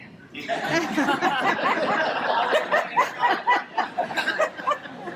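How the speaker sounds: loud high burst of laughter; laughing hard at the punchline; big laugh. Real, not acted. Laughing at her husband's halftime coaching plan, a big burst.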